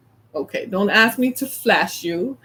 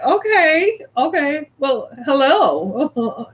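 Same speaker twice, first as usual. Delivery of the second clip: higher, sweet drawn-out hello; Southern hospitality; 4 semitones higher, much slower than usual. Retold or imitated. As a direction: kill the grump with sugary politeness. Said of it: "Southern hospitality is like".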